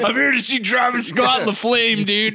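high, loud shout; mock kid's yell. Little kid at first concert, shouted high protest. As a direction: yell it like an overexcited young fan.

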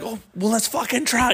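high, reckless let's try; jokingly game for anything; giddy go-along. Retold or imitated. Teens half-joking about the next prank, high and giddy. Mock agreeing to the wild idea.

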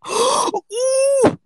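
high squealed mock ooh; mocking gasp. Mocking online ideology accounts with a squeal. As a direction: squeal it like a shocked troll. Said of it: "and they're like".

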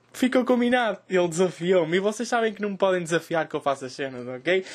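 usual speaking voice

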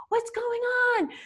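very high, drawn-out mock bewilderment; cousin Robin. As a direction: imitate a baffled caller, slow and squeaky-high. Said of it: "She's like".

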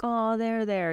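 high-pitched cooing comfort; mock nurturing. Instructor imagining comforting upset students, mock nurturing. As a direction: coo it like a pretend soothing mother. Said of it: "you know, like, very nurturing".

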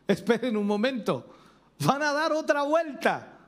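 rising mock alarm, high at the end; watchman's warning. Preacher voicing the watchman's alarm on the seventh day. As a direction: warn the city in surprise. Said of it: "el atalaya dio el aviso".

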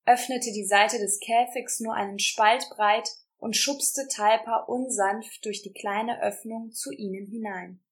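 ordinary delivery

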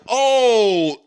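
a sudden high, loud retold gasp of surprise; mock shocked oh. Friends on a podcast, retelling seeing a nude scene, mock surprised. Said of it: "And I was like".

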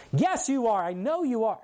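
high, emphatic retold insistence; insistent accusation. Woman insists a third time.

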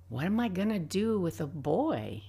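usual speaking voice

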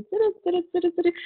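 high-pitched "turu-turu" of a saw at work; imitated sawing noise. Showing listeners how the electric knife saws, imitated sawing noise. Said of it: "é o negócio serrando, assim".

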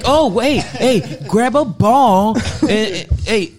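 very high, loud hammed-up pleading; mock frantic owner. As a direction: interrupt urgently in a silly high voice. Acting out panicked dog owners.

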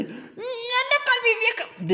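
very high, slow mocking whine; mock boyfriend. Storyteller mocking the insecure boyfriend, high and slow. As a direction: parody the jealous guy.

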